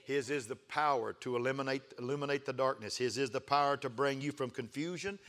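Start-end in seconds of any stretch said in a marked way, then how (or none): none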